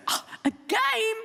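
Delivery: very high, drawn-out mock wonder; delighted surprise. His brain hears the question, high delighted surprise. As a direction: squeal it like an easily won-over child.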